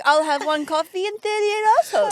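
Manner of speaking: confident Italian. Retold or imitated. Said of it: "the utter confidence".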